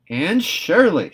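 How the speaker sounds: loud, high and slow, aghast; shocked exclamation. A narrator's reading. Marilla shocked at Anne's ruined cake, loud and aghast. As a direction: cry out her name in shock. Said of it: "she exclaimed".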